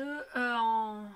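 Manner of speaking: usual speaking voice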